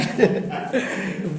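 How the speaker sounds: amused laugh, high; a short real laugh at his own jab; real chuckle. Real, not acted.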